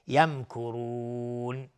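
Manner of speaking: slow recitation, the final long u of یمکرون stretched out; madd. Shown as a demonstration. Teacher demonstrating madd at a stop, long held u in یمکرون. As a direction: draw out the last vowel of یمکرون before closing on noon.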